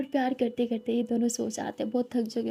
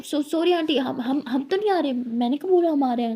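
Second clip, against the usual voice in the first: flustered; 3 semitones higher, 6 dB louder than usual. Acted. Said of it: "तो ये बोलती".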